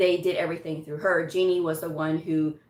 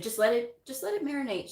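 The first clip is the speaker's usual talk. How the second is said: gentle advice; 6 semitones higher, 4 dB quieter, faster than usual. Retold or imitated. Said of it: "she said".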